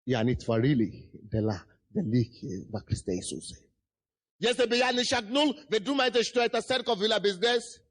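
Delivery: ordinary talk, nothing marked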